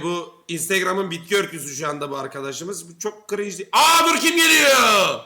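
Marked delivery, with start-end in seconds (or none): 3.7-5.2 high, loud, drawn-out holler; excited shout. Real, not acted. Streamer spotting who is coming, excited shout. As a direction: cry out at a sudden arrival.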